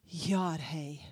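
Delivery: Yod He Vav He said low and soft, like a breath; breathed name. Shown as a demonstration. Pastor breathes God's name for the church, low and hushed. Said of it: "supposed to make you think of".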